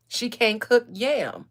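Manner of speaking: scornful jab at the princess's cooking; mock scorn. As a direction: drag out the complaint with disdain. Mocking Abraham's complaint.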